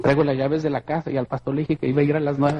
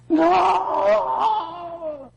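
anguished cry. Retold or imitated. Preacher reliving reaching for the body, an anguished high cry. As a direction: cry out as if losing someone.

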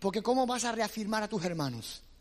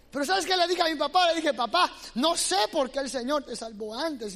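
preaching climb; 7 semitones higher, 5 dB louder than usual. Acted.

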